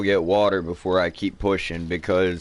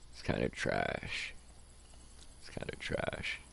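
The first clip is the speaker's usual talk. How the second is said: whispering; 12 semitones lower, 18 dB quieter than usual. Real, not acted. Mutters about damaged battery.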